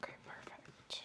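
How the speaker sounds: soft whispered acknowledgement; doctor whispering approval to her patient; whispered okay. Acted. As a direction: breathe the words out softly.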